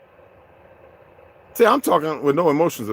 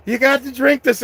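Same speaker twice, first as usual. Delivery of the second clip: imitating a Scottish woman urging him to drink, higher; mock Scottish accent, pushing the drink; 9 semitones higher than usual. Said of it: "and with that accent".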